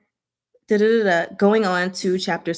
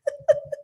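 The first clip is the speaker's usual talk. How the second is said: high laugh. Real, not acted. Laughing at flirting.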